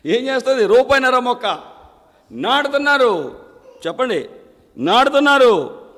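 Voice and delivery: loud preacher's challenge; preaching shout. Acted. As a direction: call it out to the crowd. Preacher presses congregation loudly.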